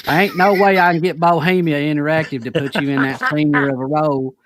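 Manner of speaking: put-on loud, hammy Kentucky accent. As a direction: talk like a Southern good ol' boy. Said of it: "Hicks is from Kentucky".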